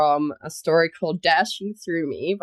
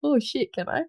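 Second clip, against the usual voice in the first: ordinary delivery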